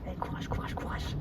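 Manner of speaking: breathless whisper to yourself; drained whispered courage; whispered encouragement. Real, not acted. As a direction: hush it out, spent. Exhausted self-encouragement.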